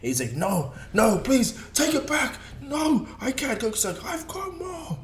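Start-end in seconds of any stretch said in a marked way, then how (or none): 0.3-3.6 high-pitched mock panic; acted pleading. Gero has reached his max, high panicked begging. Villain begs as power overwhelms him. 4.0-5.0 very high, strained-for-effect battle cry; acted power-up. Goku powers up against Gero, high acted cry.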